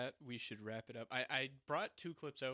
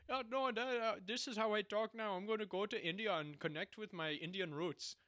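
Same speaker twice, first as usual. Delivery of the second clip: a light mock Indian accent, louder and higher; 8 semitones higher, 7 dB louder than usual. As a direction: tease it with a hammed-up Indian accent. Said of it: "start going".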